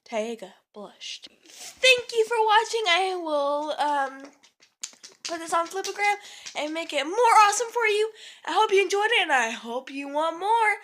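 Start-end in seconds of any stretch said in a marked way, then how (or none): 1.5-10.8 excited outro. Real, not acted.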